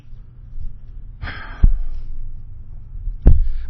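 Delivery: long, heavy exhale; exasperated sigh. Real, not acted. Broadcaster fuming over a blackout, exasperated sigh. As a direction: blow out the frustration in a long breath. Fed up after blackout on air.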